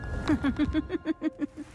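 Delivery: high, gloating laugh; evil giggle after a taunt; rippling mocking laughter; villain's giggle.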